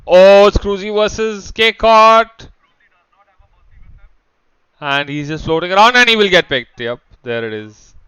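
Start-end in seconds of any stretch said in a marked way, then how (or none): 0.1-2.5 loud, high and slow hype; excited call. Real, not acted. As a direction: stretch it out like a big showdown. Caster hyping a duel.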